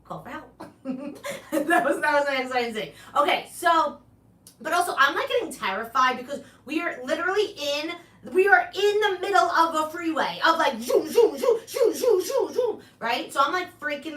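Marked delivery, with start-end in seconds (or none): 0.7-1.5 short laugh. Real, not acted. Laughs at own story. 10.8-12.8 loud, high zooms of passing cars; zooming car sounds. Retold or imitated. Imitating cars racing past.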